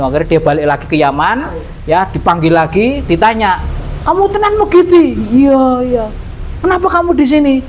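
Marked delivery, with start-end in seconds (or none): 4.0-7.6 high calls down the well; calling into well. Acted. Man calling questions to the voice in the well, high.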